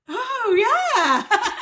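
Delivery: high, loud cry breaking into laughter; acted sudden realisation. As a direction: let it dawn on you, half crying, half laughing. Sudden realisation after crying, then laughs.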